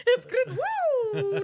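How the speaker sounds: very high, loud whoop; hammed-up crowd going wild; mock cheering. Comedian acting out a crowd seeing green, cheering.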